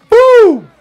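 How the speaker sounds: a sudden high, loud "Boom!"; excited shout. Real, not acted. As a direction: celebrate the bucket at full voice. Celebrating a made shot.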